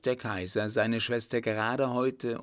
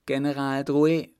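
loud, higher-pitched summons; emperor's raised-voice command; commanding call; 5 semitones higher, 7 dB louder than usual. A narrator's reading. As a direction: call out an order to fetch the general. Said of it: "befahl er dem Diener".

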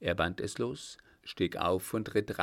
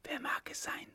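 whispered, higher question; 7 dB quieter than usual. A narrator's reading. Comrades at the roadside cross, whispering. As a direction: breathe the question out in a hush. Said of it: "flüsterte einer".

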